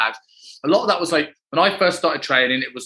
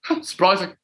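mock surprise; 5 semitones higher than usual. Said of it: "He's like".

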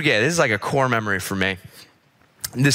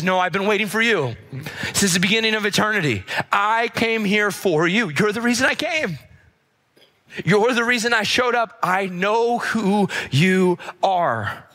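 impassioned declaration; 7 semitones higher than usual. Acted. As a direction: build higher, stretching the words with conviction. Jesus answering Bartimaeus, voiced by preacher.

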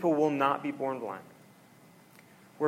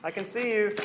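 excited outburst; 8 semitones higher than usual. Retold or imitated. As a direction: catch the cheat in glee. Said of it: "he looks at the guy and is like".

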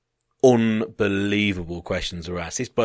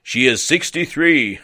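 put-on loud, slow German-accented answer, pitched up; 5 semitones higher, 6 dB louder, slower than usual. German man answering the desk worker, mock German accent. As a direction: imitate an earnest German, word by word. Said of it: "it was in German accent".

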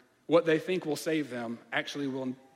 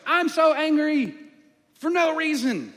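high, loud, slow hammed-up fury on "so angry"; mock angry outburst; 11 semitones higher, 8 dB louder, slower than usual. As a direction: blow up like a petulant hothead, slow and loud. Illustrating how anger sounds.

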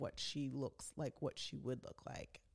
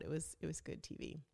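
ordinary talk

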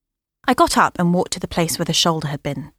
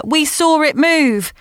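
shouting; 9 semitones higher, slower than usual. Acted. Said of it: "Lena shouted through the computer".

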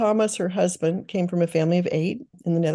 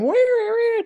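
put-on high, louder toddler whine; whiny child voice; 4 dB louder than usual. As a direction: whine like a little kid. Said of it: "learned quickly to go from".

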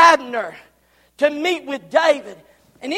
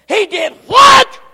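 loud high disbelieving shout; can't believe what he hears; outraged disbelief; 5 semitones higher, 9 dB louder than usual. Acted. Joab hearing of the peace deal, loud disbelief.

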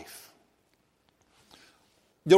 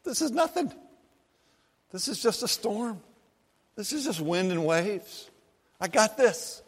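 casual unbothered Jesus; 5 semitones higher, 6 dB quieter than usual. Retold or imitated. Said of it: "Jesus essentially walks out and says".

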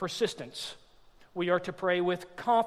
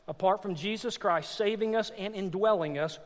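ordinary delivery

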